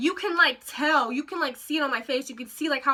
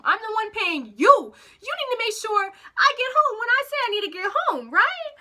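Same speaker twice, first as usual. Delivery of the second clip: higher, insistent retold telling-off; indignant rant; 7 semitones higher than usual. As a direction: climb in pitch to a demanding finish. Rant aimed at slow driver.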